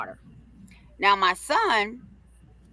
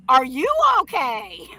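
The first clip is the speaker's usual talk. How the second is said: mocking echo; 4 semitones higher than usual. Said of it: "My son starts laughing at him".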